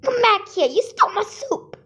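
high loud shriek after the thief; shrieking jackpot winner. Acted. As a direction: shriek with a laugh. Chasing the soup thief.

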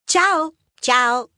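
put-on high bright kids' hello; child greeting. Kids cheerfully greeting the young mouse Mar, high child voice. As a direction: greet her like eager little mice.

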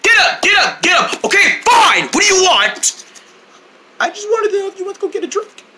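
put-on high, pushy kid voice for Tun; pestering friend voice. Tun bugging Bonner to come out, high nagging voice. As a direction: nag him like an eager little friend. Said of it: "he kept bugging me".

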